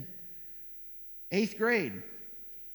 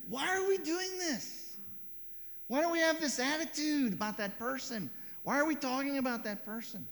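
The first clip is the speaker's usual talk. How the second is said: high, pleading divine voice; the Spirit asking sadly why, in a higher voice; grieving Holy Spirit; 10 semitones higher than usual. Acted. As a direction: plead gently as the hurting Spirit. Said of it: "when he's saying".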